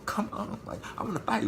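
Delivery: breathy near-whisper of a dare to fight; hushed taunt. Retold or imitated. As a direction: murmur the challenge under your breath, egging her on. Hissed taunt while waiting, cold.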